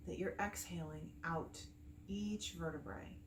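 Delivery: calm meditation guide with long pauses between phrases; unhurried, soothing breathwork instruction; guided meditation. Acted. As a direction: gently lead them through the breathing, leaving space after each phrase.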